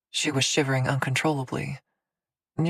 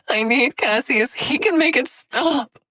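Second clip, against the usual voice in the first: desperate; 11 semitones higher, 6 dB louder than usual. A narrator's reading. Frantic pleading.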